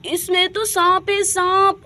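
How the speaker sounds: high shocked cry; shocked discovery. Acted. Woman finding a snake in the milk pot, high and shocked. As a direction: gasp it out seeing the snake.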